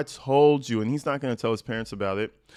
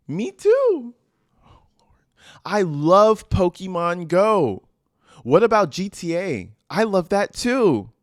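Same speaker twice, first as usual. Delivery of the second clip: mock girly voice; 5 semitones higher, 6 dB louder than usual. Reading Lisa's message in voice.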